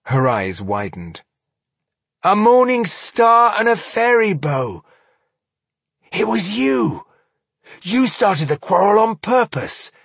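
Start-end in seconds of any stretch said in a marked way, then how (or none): 2.2-9.9 high, loud sudden realization; accusing realization. Acted. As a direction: it dawns on her, accusing. Realizing the dwarves' trick.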